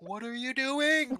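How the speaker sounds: high, slow drawn-out call from another room; mock shocked bandmate. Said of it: "I'll hear Chris in the other room go".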